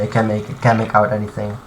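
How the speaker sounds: ordinary delivery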